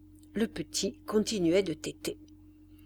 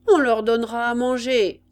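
louder military call; sergeant's shout; 3 semitones higher, 7 dB louder than usual. A narrator's reading. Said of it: "cria le sergent". (cri de sergent)

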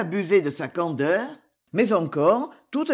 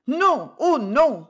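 high, drawn-out protest; slow, pleading young lady's refusal; emphatic denial; 6 semitones higher, 4 dB louder, much slower than usual. Acted. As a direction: draw out each word in high-pitched distress. Rosa protests to the lawyer.

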